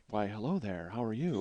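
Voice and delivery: low, soft, debonair greeting; suave smooth talker. Pretend. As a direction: play it like a lounge-lizard charmer. Imagined suave reply to topless woman.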